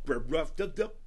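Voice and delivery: burping the words out; burp talking. Acted. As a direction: talk through a burp. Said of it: "that was my rewind, rewind voice".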